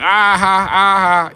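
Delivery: high loud mocking "ah ha"; hammed-up chuckle at the door; mock laugh. Mimicking people at your door.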